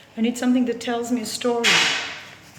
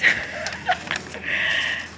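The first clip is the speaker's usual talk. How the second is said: breathy laugh; 5 dB quieter than usual. Real, not acted. Laughs at German word joke.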